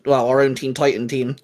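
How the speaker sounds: usual speaking voice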